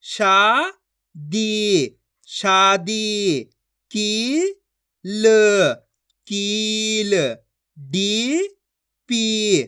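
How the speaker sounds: each syllable stretched long and held; very slow syllable-by-syllable reading demo; drawn-out pronunciation. Shown as a demonstration. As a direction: draw out every vowel like a reading teacher. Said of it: "खींच खींच के".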